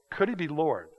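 ordinary talk

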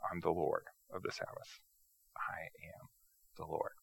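very soft, spaced-out solemn claim; quiet, weighty statement; hushed declaration. Acted. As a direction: drop to near-whisper, pausing between phrases. Voices Jesus's climactic claim, hushed.

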